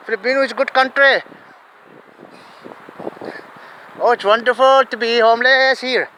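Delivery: hammed-up Filipino accent, held level then dropping at phrase ends; Filipino or Chinese accent. Newcomer from China or Philippines introducing himself, mock Filipino accent. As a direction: imitate a Filipino English speaker with a sing-out monotone. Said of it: "he will speak".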